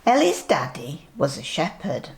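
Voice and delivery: usual speaking voice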